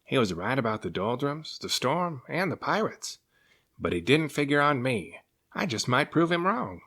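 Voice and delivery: a hint of rising resolve; determined serpent. A narrator's reading. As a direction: talk yourself into it. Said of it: "Cyrus muttered to himself".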